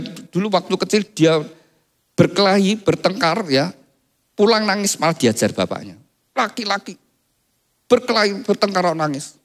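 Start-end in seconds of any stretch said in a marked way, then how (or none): 6.4-9.4 ayah galak. Retold or imitated. Ayah tentara memarahi anak menangis.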